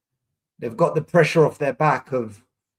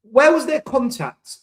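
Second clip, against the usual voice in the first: incredulous protest; 8 semitones higher, 5 dB louder, slower than usual. Real, not acted. Fan outraged at referee decision.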